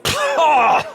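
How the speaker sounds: sharp high cry of disgust; disgusted shriek. Acted. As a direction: recoil with a shrill shout. Disgusted sorcerer tasting coffee.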